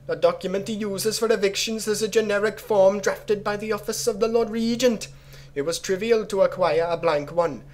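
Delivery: high, steady put-on accent, vaguely Jamaican; bad pseudo-Jamaican accent. Player reading the plot against Timsh, strained pseudo-Jamaican accent. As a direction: labour to keep up a Jamaican-ish accent. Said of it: "Trying to maintain this accent".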